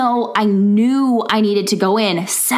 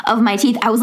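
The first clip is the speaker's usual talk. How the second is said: ordinary talk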